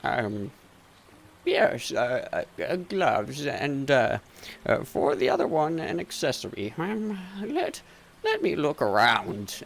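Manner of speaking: halting, wavering merchant voice; hard-of-hearing shopkeeper, flustered. Acted. Shopkeeper who misheard gloves as club, flustered and hesitant. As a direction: fumble through the order after mishearing.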